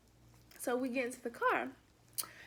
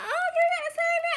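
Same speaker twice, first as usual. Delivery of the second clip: high, fast, bubbly waitress voice; high, quick, sugary mock waitress; smiling waitress; 5 dB louder, faster than usual. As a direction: chirp it out with a smile. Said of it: "the girl smiled like".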